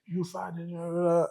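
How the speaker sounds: high, soft mock babble; swearing Italian driver. Italian driver cursing at the blocked road, imitated high and soft. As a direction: imitate a ranting driver with run-on nonsense. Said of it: "this Italian guy is driving".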